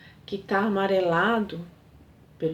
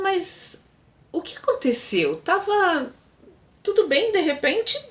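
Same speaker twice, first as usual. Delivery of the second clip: much higher distressed plea; 8 semitones higher than usual. Retold or imitated. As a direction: beg him to explain, voice climbing. Alice pleads as Mário leaves.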